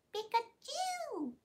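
squeaky high Pikachu cry; drawn-out squeaky anime squeal; high cute anime girl. Pretend. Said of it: "Can you do an anime voice?".

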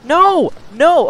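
sharp high shout of no; panicked yell. Real, not acted. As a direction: yell out in alarm. Caught by monster, yelling.

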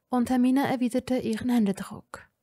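ordinary delivery

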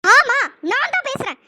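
loud, slow, cutting reply; wife answering her husband defiantly, angry; defiant retort. Acted. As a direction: throw it back at him.

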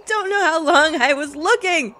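rattled laugh breaking into high, loud words; shaking with laughter. Real, not acted. Player shaking after the long search, rattled laughing. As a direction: laugh helplessly, then wail it out.

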